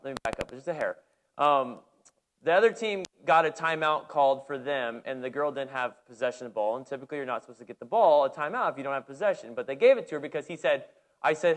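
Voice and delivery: usual speaking voice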